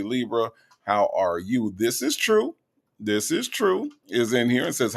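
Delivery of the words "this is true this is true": playful name call, pitched high. Pretend.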